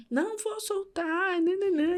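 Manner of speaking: high, slow, drawn-out refusal; insistent retort. Retold or imitated. Retelling a struggle at bedtime, insistent. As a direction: stretch it out high, imitating a stubborn retort. Carer restraining child at bedtime.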